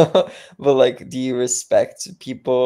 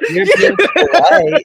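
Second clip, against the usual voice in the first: loud, high-pitched laughing protest; laughing tease; 11 dB louder than usual. Real, not acted. Friend calling out her politeness, laughing loudly. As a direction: call her out while cracking up. Teasing her over claimed respect.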